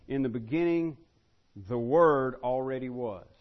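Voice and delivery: loud, deliberate, with a pause before "the Word already was"; emphatic paraphrase. Shown as a demonstration. Preacher paraphrasing John's opening for the class, loud and emphatic. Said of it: "I like to paraphrase it this way".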